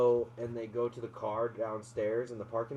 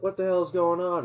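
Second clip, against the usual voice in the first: mock alarmed shout; 5 semitones higher, 6 dB louder than usual. Neighbors alarmed.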